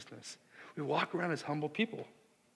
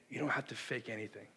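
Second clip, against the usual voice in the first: soft, lower, tender assurance; hushed words of a loving groom; reassuring groom; 4 semitones lower, 6 dB quieter than usual. Acted. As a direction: reassure them gently and quietly.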